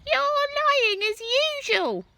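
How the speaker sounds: put-on very high, loud accusing old woman; sneering grandma shouting; Grandma yelling. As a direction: scold him from above. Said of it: "Grandma yelled".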